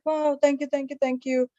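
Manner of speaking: happily overwhelmed thanks to a kind stranger; gushing thanks. Retold or imitated. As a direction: gush with grateful excitement. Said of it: "I was so happy".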